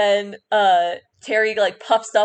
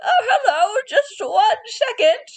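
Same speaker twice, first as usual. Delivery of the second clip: put-on high, thin old shopkeeper voice; a sweet old woman calling from the back room; 13 semitones higher than usual. DM voices the old shopkeeper greeting customers, high and creaky. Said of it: "the same voice of the old woman".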